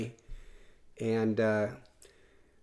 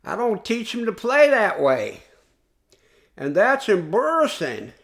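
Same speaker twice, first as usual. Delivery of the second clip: put-on Bobby Ross Southern accent; 8 semitones higher, 6 dB louder than usual. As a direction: imitate the Southern coach, loud and high. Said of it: "that's my Bobby Ross impersonation".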